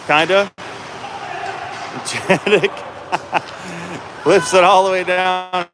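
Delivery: chuckling through the call; laughing while calling. Real, not acted. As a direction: laugh between words. Laughing at a botched play.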